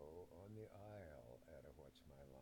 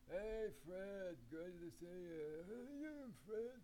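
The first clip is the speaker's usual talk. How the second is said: much higher, loud, drawn-out friendly hail; street bum greeting; 13 semitones higher, 6 dB louder, slower than usual. Retold or imitated. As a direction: call out like a cheery panhandler. Bums greeting Fred Allen retold.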